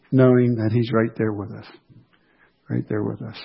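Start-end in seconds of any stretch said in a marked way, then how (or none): 2.7-3.4 much softer, almost to himself; a hushed repeat of the line; soft echo. Real, not acted. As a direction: let the echo fall away quietly.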